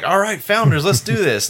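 rally yourself before a big sniff; hammed-up pep talk to a beer; pumped up. Retold psyching up before a sniff.

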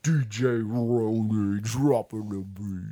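slow, stretched-out DJ voice; imitated DJ drop. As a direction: drag it out like a DJ drop. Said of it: "He's like".

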